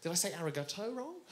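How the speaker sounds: low, hushed acted dismay; worried self-questioning. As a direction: quietly wonder what you did wrong. Worried aside after offending the chef.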